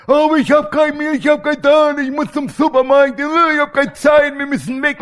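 imitating his wife in a flustered panic; frantic wife. Pastor imitating Bärbel panicking about no milk, high and loud.

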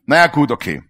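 usual speaking voice

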